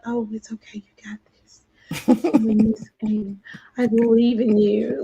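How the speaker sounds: hushed, soothing mom voice; reassuring mama. Retold or imitated. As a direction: gently cheer on an upset child. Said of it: "I'm being mama over here".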